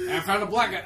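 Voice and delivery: hammed-up cop on the radio; bad cop impression. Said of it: "I'm a bad cop impression guy".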